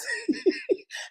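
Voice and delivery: high, soft giggle; cracking up. Real, not acted. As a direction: burst into giggles at your own story. Cracks up at retold quote.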